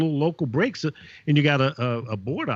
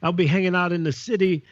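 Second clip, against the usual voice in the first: higher-pitched hip radio DJ patter; trying to be hip; 6 semitones higher than usual. Retold or imitated. Newcomer DJ on air in Oakland, trying to be hip. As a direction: play the DJ straining to sound cool. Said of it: "trying to be hip and everything".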